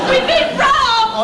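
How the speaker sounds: slow, drawn-out cry of alarm; wife announcing the burglary in a frantic wail; frantic outburst. Acted. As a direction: blurt it out in a panic. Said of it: "just calm down".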